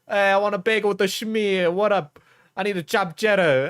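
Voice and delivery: put-on New York accent. Streamer mocking a coffee guy named Joe, New York accent. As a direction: play the pushy New Yorker with a New York accent. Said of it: "that's an accent that we all have".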